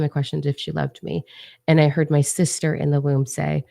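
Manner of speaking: ordinary delivery, nothing marked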